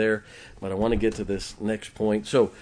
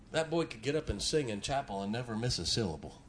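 ordinary talk

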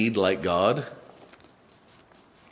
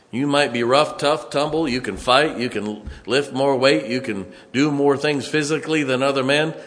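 loud, raised preacher's build; fiery preaching; 6 semitones higher, 5 dB louder than usual. Acted. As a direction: thunder it from the pulpit. Preaching at full force.

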